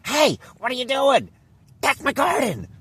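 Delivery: high, loud shrill-free yell of an angry neighbour; screaming Karen. Retold or imitated. Karen yells at the gardener from her passing Jeep, high and loud. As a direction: scream it like an outraged Karen from a car window. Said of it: "this crazy Karen's driving by, she goes".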